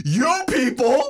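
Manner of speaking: "You people?" पर ऊँचा, धीमा, उठता सवाल, हँसी के साथ; incredulous question. Retold or imitated. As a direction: repeat "You people?" as a high, slow, rising question while laughing. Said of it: "With a question mark".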